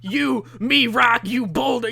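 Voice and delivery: high, loud mock wrestler shout; hyped wrestler promo. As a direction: bellow it like a cocky heel calling out a rival. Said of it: "He's like, he's, like, pointing to himself".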